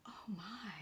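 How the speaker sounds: very soft, low "oh my"; hushed dismay. A narrator's reading. Narrator watches the cart take Mister Logan, hushed dismay. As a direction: let it out under your breath, appalled. Shocked aside as cart rolls away.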